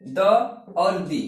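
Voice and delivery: द then दी, short dha then long dee; two ways to say the. Shown as a demonstration. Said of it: "इसको बोलते हैं".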